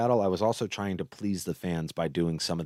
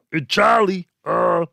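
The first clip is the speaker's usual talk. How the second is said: high, loud, exaggerated caricature voice; loud mock 1920s gangster; DNA; 9 semitones higher, 6 dB louder, slower than usual. Shown as a demonstration. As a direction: blurt it as a gross troglodyte caricature. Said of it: "do the D N A voice".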